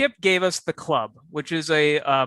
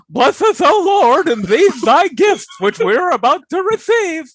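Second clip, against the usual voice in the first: high, loud clown voice; clown saying grace; 12 semitones higher, 7 dB louder, slower than usual. Pretend. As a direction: pray like a silly clown. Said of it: "do your impression of a clown saying grace".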